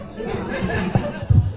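high airy burst of laughter. Real, not acted. Mocking his hot-sun plan, high laugh.

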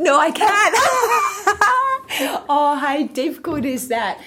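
high, bursting laugh while declining; laughing refusal. Real, not acted. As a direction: laugh it off, embarrassed. Asked to do an Aussie accent.

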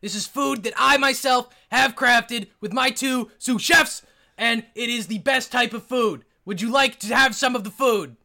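loud, high, over-enunciating chef; over enunciating every word; shouting chef. Acted. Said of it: "You don't have to shout at me".

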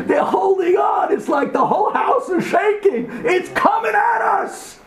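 high-pitched, drawn-out alarm; frantic high warning cry; panicked shouting. Acted. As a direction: cry out as the danger bears down on you. Acts terrified family as house shakes.

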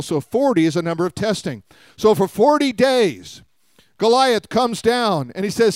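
ordinary talk, nothing marked

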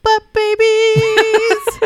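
slow, loud drawn-out shout; excited shout. Real, not acted. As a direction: yell it out, stretched and gleeful. Blurts a silly catchphrase.

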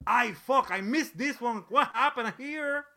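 hammed-up Mexican accent of a frustrated director; Guillermo del Toro accent. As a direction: sigh over a missed idea in a Mexican accent. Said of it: "that is what he'll say in that exact accent".

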